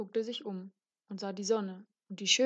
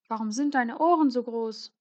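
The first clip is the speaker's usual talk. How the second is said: Rotkäppchen asking; 4 semitones higher, 6 dB louder than usual. A narrator's reading. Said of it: "fragte Rotkäppchen".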